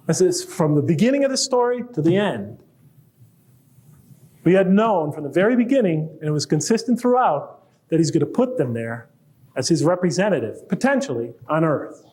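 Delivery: ordinary talk, nothing marked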